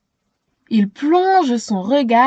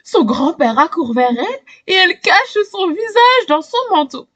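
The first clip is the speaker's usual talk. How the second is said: ordinary delivery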